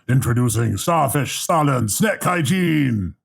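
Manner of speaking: slow, shouted monster introduction; announcer shout. Acted. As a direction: bellow it like a ringmaster. Villain announcing his monster.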